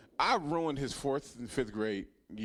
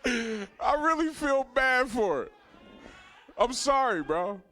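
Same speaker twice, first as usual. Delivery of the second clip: emotional apology; 10 semitones higher, 5 dB louder, much slower than usual. Real, not acted. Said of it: "See, I'm getting all emotional".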